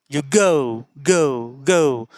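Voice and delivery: "go" with a long stretched o; long o in "go", American English; long drawn-out American go. Shown as a demonstration. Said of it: "ओ का जो part है वो बहुत ही तेज और बहुत ही लंबा".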